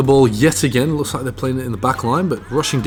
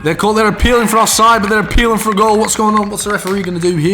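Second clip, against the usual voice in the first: higher and louder, urgent; agitated confusion; 8 semitones higher, 4 dB louder than usual. Real, not acted. Commentator baffled by the referee's goal call, agitated. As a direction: demand to know what is happening.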